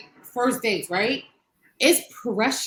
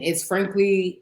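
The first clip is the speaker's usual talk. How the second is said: ordinary talk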